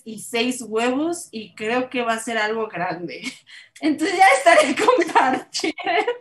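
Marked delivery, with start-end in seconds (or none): 3.2-6.1 high, laughing through the words; amused laughing talk. Real, not acted. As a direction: giggle while promising to share. Laughing about the recipe.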